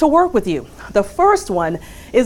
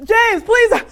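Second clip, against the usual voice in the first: [name] plea; 13 semitones higher than usual. Retold or imitated. Coworker imitating [name] begging departing James to stay. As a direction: beg like a soul singer on his knees. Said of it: "in the words of another famous James".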